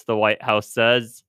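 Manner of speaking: usual speaking voice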